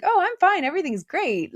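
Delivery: mock a forced I'm fine; hammed-up pretend cheer; fake cheerful. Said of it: "pretend like".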